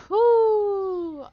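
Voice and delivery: loud high cheer; loud triumphant whoop; excited whoo. Real, not acted.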